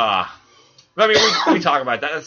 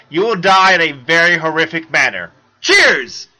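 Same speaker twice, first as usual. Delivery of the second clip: mock-dramatic reading; 8 dB louder than usual. Reading author's email aloud.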